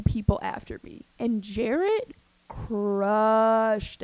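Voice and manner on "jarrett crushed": drawn out. Real, not acted. Drawn-out emphasis.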